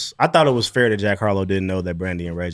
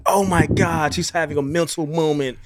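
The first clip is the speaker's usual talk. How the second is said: mock awe; 6 semitones higher than usual.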